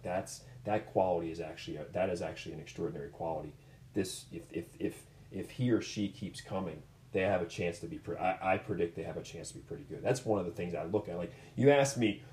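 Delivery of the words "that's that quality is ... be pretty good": soft reassurance. Retold or imitated. Coach reassuring upset parents.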